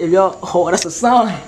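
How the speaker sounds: ordinary talk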